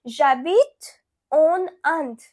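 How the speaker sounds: slow, careful French model sentence; slow French demo. Shown as a demonstration. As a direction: spell out J'habite en Inde slowly for learners. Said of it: "you will say".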